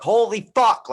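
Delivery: sudden high yelled curse; panicked shout. Retold or imitated. Gym member collapsing, retelling own panic, shocked shout. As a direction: blurt it out in shock, loud and high.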